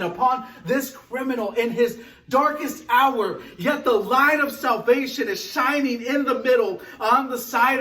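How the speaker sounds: rising, urgent sermon voice; full-force pulpit delivery; preaching. Acted. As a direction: preach it out to the congregation with growing fire.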